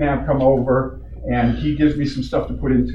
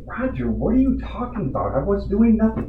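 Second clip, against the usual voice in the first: incredulous protest; 9 semitones higher than usual. Retold or imitated. Retold argument, protesting his innocence.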